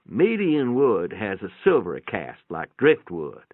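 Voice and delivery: ordinary delivery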